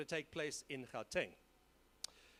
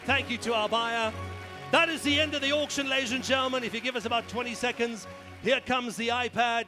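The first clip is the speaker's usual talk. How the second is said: loud, high triumphant shout; shouting sold; 4 semitones higher, 10 dB louder than usual. Real, not acted. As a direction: bang it out: sold. Hammer falls, shouting sold.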